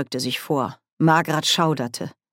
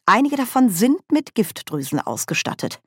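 higher lecturer's delivery; lecturing tone; 7 semitones higher than usual. A narrator's reading. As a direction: state it like a professor. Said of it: "im Tonfall eines Dozenten".

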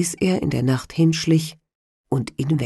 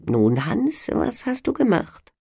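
devil; 7 semitones higher than usual. Acted. Devil questions Hans.

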